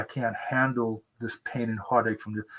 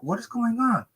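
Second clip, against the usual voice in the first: baffled; 11 semitones higher than usual. Retold or imitated. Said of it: "And I'm going like".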